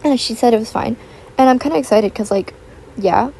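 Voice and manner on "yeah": usual speaking voice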